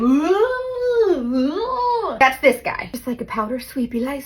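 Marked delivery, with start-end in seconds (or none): 0.0-2.2 drawn-out car-wash "woo"; car wash imitation. Like the guys at the car wash, imitated whoosh. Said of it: "it's like".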